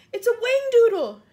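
very high, very loud cry; alarmed yell. A narrator's reading. Fire chief naming the monster, yelling. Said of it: "yelled the head of the fire department".

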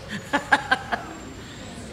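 giggling high and airy; breathy laugh up high. Real, not acted. Laughing at the food.

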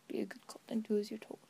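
fast hushed villain whisper; menacing whisper. Acted. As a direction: hiss the order quickly.

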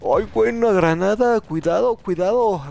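sudden high yell of alarm; grenade warning yell. Real, not acted. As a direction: shout a warning about the grenade. Grenade incoming in game.

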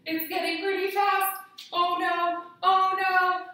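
put-on kids' yoga teacher hamming up a storm; playful alarm. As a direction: act scared the wind will knock you down. Wind storm again, playful alarm.

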